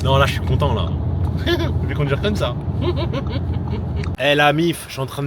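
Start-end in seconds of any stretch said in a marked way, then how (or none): 0.9-1.8 a quick, much higher giggle; short laugh. Real, not acted. Laughing in the car. 2.6-4.2 a soft unvoiced laugh; quiet laugh; airy laugh. Real, not acted. Laughing while driving.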